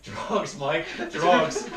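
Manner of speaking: loud, high mock-panicked outburst; imitated hysterics. Retelling his brother's phone confession, mock hysterical. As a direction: blurt it out like someone hysterical, half laughing. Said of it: "just in fucking hysterics".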